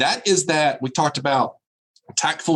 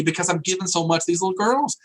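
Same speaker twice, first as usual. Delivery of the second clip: ordinary talk, nothing marked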